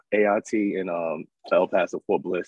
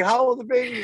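higher urgent asking; anxious question. Retold or imitated. Asking the friend, high anxious voice. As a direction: press for the answer. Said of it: "I was like".

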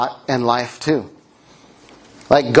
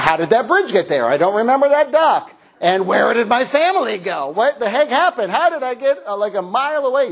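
confused panic; 8 semitones higher than usual. Retold or imitated. Lost at sea, confused.

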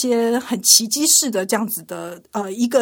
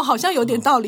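usual speaking voice

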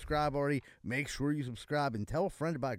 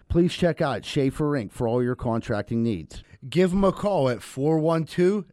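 hammed-up ad read; 10 dB louder than usual. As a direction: bark it like a cheesy TV commercial. Second mock ad, louder.

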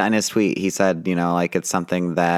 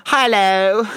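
theatrical hello; 11 semitones higher, 6 dB louder than usual. Pretend. Theatrical greeting.

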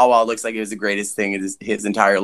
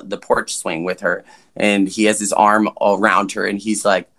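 ordinary talk, nothing marked

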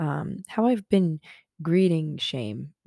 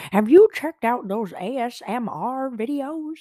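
high, loud mock-grandma voice; 7 semitones higher, 7 dB louder than usual. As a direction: ham it up like an out-of-touch grandma. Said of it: "I'm such a grandma".

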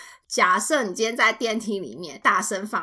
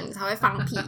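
ordinary talk

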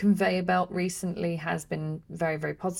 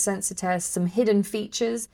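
ordinary talk, nothing marked

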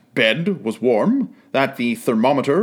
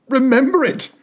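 much higher, louder burst of fervor; fervent cry; 11 semitones higher, 3 dB louder than usual. A narrator's reading. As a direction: exclaim it with eager fervor. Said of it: "cried Scrooge with fervor".

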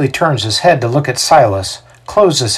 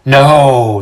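loud, higher protest; crowd outcry; 4 semitones higher, 6 dB louder than usual. A narrator's reading. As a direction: erupt like an outraged crowd. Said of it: "the crowd erupts again".

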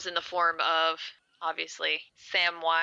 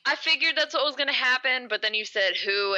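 ordinary talk

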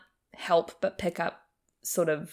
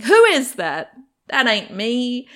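mock indignation; 7 semitones higher, 9 dB louder, slower than usual. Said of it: "I'm like".

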